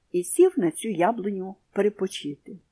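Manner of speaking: usual speaking voice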